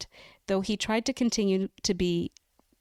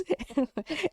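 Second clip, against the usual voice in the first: embarrassed giggle; 8 semitones higher than usual. Real, not acted. Laughs at hard name.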